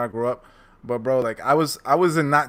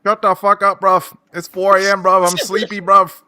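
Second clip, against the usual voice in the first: London accent impression; 6 semitones higher, 9 dB louder than usual. Streamer doing his London accent for friends, loud and high. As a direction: do the Leon Edwards London bit, loud and cheeky. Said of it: "Leon Edwards, uh, line you always do".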